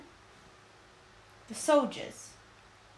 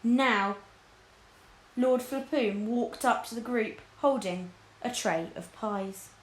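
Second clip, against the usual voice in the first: ordinary delivery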